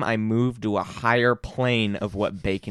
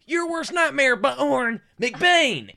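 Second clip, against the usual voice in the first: much higher, manic action-hero voice; Gary Busey impression; 13 semitones higher, much slower than usual. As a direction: yell it like an unhinged action star. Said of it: "Gary Busey going".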